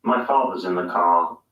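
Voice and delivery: British non-rhotic delivery; British accent. Shown as a demonstration. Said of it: "Listen to the difference".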